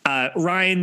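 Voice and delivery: ordinary talk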